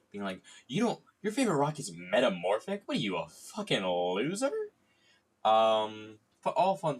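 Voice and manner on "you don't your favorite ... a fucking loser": teasing outraged jab, higher and louder; mock yelling at a friend. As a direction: playfully yell at your friend for their bad rock. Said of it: "fun categories that you can yell at your friends about".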